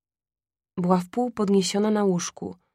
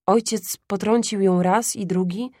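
usual speaking voice

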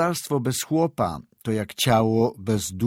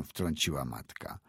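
usual speaking voice

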